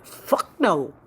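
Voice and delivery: loud punched fuck no; loud blunt refusal; blunt mock refusal. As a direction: bark it out blunt. Janet's real meaning, punched.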